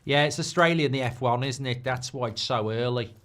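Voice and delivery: normal talking voice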